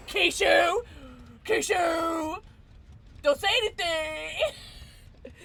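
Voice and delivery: high, loud call, last words drawn out; mock pleading shout. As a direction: call out in playful panic. Playfully warning Keisha.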